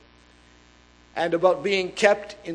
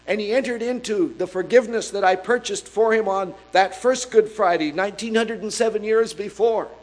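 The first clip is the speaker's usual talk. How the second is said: louder, higher, driving sermon voice; fervent preacher building the case; preaching at full force; 5 semitones higher, 4 dB louder than usual. Acted. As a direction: preach it out to the whole church.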